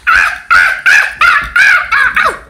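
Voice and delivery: rapid very high breathy squeals; high ah-ah shrieks; high shrieking ahs. Pretend. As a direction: shriek like a wired kid. Hyper screaming.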